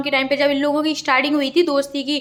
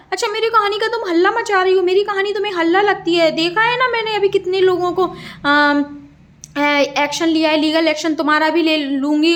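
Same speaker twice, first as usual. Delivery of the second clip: higher and hotter, imitating a furious threat of legal action; retold heated threat; 4 semitones higher than usual. Imitating didi threatening narrator with legal action, heated. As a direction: mimic her escalating, raised-pitch rant. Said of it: "सीधा".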